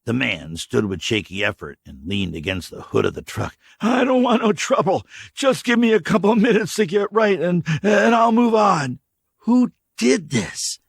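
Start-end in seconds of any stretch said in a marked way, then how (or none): 3.8-9.0 high, loud, pleading stranger building to a shout; nervous drifter. Acted. Stranger insisting he wants no trouble, pleading shout. 9.5-10.8 slow, high, pointed question; direct. Acted. K J interrogating the stranger, slow and pointed. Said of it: "K J asked directly".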